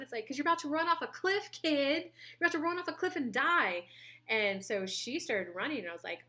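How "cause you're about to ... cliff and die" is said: higher-pitched mock-exasperated warning; urgent warning. Adult warning a kid running toward a cliff, urgent warning. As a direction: raise the pitch and press the danger home.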